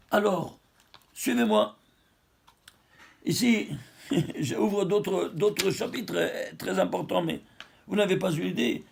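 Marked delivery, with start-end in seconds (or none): none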